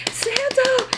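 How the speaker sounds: overjoyed squeal; pumped shout. Retold or imitated. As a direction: scream his name like a thrilled kid. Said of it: "He gets so fucking pumped".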